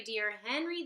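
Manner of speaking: plain reading voice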